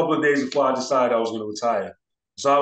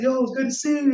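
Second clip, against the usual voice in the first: excited greeting; 11 semitones higher than usual. Retold or imitated. Greeting her from stage.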